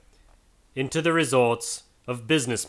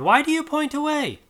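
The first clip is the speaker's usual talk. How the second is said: very high, puzzled protest; a baffled old man's squeak of alarm; bewildered cry. A narrator's reading. Scrooge protesting to the pointing spirit, very high. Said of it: "Scrooge exclaimed".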